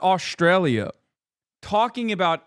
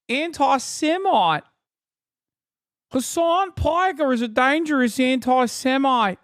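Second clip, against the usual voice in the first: higher-pitched Australian TV host parody; exaggerated Australian broadcaster twang; mock Australian accent; 5 semitones higher than usual. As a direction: do a hammed-up Aussie news presenter. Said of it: "can't tell if it's you talking".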